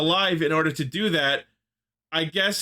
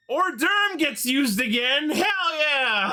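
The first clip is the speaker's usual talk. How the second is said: high, slow thrilled cheer; excited; 10 semitones higher, slower than usual. Real, not acted. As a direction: cheer it like a delighted fan. Cheering a returning power.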